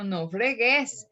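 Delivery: slow "no fregues", stress on GUÉS; stress shifted to end. Retold or imitated. Teacher contrasting the character's wording, "fregues" stressed on the end. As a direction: stretch "fregues" onto its final syllable. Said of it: "hace su acento en otra sílaba distinta".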